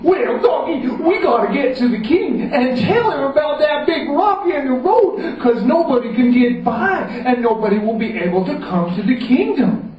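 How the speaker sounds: rally the donkey to go; urgent Common John. Acted. Common John urges the donkey to the king, urgent.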